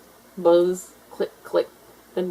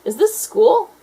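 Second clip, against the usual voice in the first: high curious question; 7 dB louder, slower than usual. A narrator's reading. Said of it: "said Bridget".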